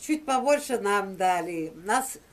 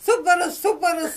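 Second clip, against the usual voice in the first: higher, louder repeated correction; loud insistent quote; 7 semitones higher, 5 dB louder than usual. Retold or imitated. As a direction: insist on the word loudly. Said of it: "Она".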